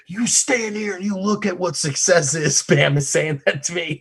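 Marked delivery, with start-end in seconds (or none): 0.1-2.6 mock lecture. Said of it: "he's like".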